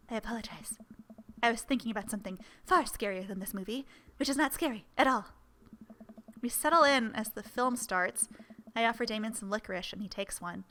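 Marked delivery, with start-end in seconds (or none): none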